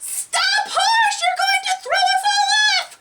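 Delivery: very high, fast squeal; panicked shouting. Acted. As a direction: cry out to stop the horse before falling. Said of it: "shouted as loud as they could".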